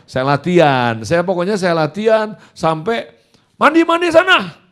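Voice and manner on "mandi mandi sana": loud, very high barked order; snappish father. Retold or imitated. Father sends son to bathe, snappish high shout. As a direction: imitate dad shouting him off. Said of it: "Dia kalau ngomong nyolot begitu".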